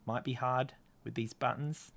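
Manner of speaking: ordinary talk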